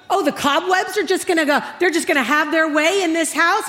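hammed-up martyr griping; mock indignant. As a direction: act out an exasperated housework rant. Acted indignant rant over housework.

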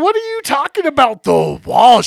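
loud, high-pitched disbelieving question; retold incredulous protest. Kid questioning Jules's order to scrub walls, incredulous. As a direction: jump up high, then hit "walls" very loud.

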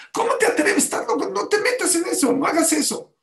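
very high, loud, alarmed reproach; outraged scolding. Retold or imitated. Second paediatrician forbids the injections, outraged scolding.

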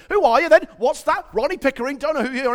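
hammed-up cocky biker; motorcyclist taunting. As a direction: taunt the driver with mock disbelief. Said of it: "So the motorcyclist is going".

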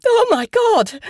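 very high, loud cry of shock; shriek. A narrator's reading. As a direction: shriek it in horror. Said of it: "Her voice came out as a shriek".

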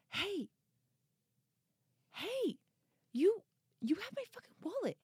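high, fast strained yell; sudden high-pitched confrontation. Retold or imitated. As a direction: call him out in a voice you don't recognize. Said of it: "it wasn't even my voice".

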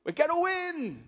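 high, loud team yell; near-scream rallying shout. Acted. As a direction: belt out the team's final cheer. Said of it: "they scream at the top of their lungs".

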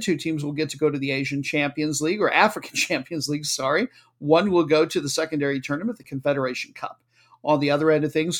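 ordinary talk, nothing marked

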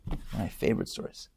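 whispered quickly, almost to himself; whispered aside. Shown as a demonstration. Speaker to audience, whispering a repeat of his favorite story. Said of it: "It's one of my favorite stories".

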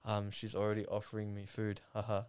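lightly voiced stiff player; awkward delivery. Imitating the player awkwardly reading a line to camera. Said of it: "he's doing it so awkwardly".

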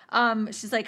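ordinary delivery

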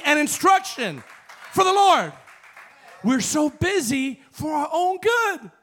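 high, loud preaching; fiery preacher calling out the congregation; full-force preaching. Acted. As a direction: challenge the church at full voice.

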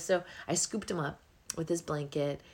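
ordinary talk, nothing marked

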